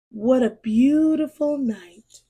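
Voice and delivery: ordinary delivery, nothing marked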